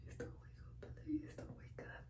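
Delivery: barely audible, breath-only whisper; pleading whisper. Real, not acted. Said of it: "I'm whispering 'cause my baby's sleep".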